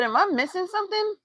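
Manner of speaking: high incredulous squeak. Retold or imitated. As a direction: ask it as if truly baffled. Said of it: "I said".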